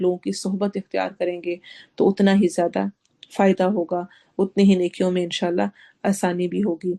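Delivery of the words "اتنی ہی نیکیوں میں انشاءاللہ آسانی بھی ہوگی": usual speaking voice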